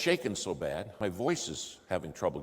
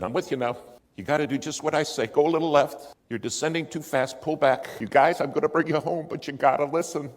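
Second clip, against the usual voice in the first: higher, louder, urgent commands through the turbulence; shouting over storm; 8 semitones higher, 10 dB louder than usual. Retold or imitated. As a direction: yell the instructions over the noise. Controller talks them through storm.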